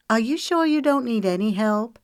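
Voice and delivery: teasing, lifted call across the kitchen; playful tease toward the kitchen. Acted. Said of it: "she called out playfully".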